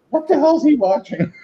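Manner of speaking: high-pitched mock outrage as grandpa; grandfather. Grandfather walking in on the TV painter, high mock outrage. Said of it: "my grandfather comes in".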